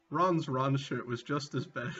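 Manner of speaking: usual speaking voice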